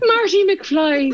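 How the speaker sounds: high, drawn-out Irish lilt; awful Irish accent. Retold or imitated. Actor reprising her audition warm-up, slow high put-on Irish accent. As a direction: stretch the name in a mock Irish accent. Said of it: "awful Irish accent".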